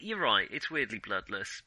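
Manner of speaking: usual speaking voice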